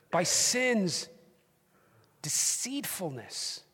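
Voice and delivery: urgent, rising preacher voice; fervent preaching. Real, not acted. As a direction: drive the warning home to the church. Preaching at full force.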